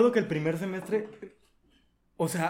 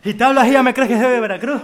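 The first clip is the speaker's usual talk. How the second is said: fast, loud, high Veracruz accent; laughing, exaggerated coastal Veracruz impression; costeño accent; 7 semitones higher, 11 dB louder, faster than usual. Shown as a demonstration. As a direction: rattle it off in a broad costeño accent. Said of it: "Ese es el acento costeño por excelencia".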